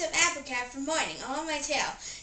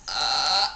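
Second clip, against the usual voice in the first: loud burp; 10 semitones lower, 7 dB louder than usual. Acted. Burping contest, burps.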